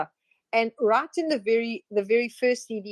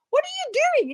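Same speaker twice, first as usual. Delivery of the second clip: much higher mock woman's voice; mimicked woman; faster than usual. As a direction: squeak it up as the troubled wife. Woman soaked with water.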